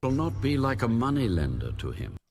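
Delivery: usual speaking voice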